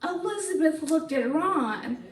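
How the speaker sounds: ordinary delivery, nothing marked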